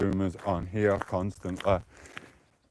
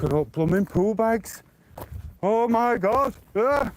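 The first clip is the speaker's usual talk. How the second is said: high, rising squeal of disgust; real high-pitched disgusted squeal; disgusted shriek; 12 semitones higher, slower than usual. Real, not acted. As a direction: shriek at something gross. Sees gross pool bags, shrieks.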